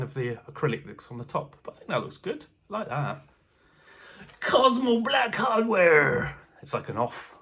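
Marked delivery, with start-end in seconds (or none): 4.4-6.4 slow, grand product announcement; mock announcer. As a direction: announce it like an advert. Announcing feature name.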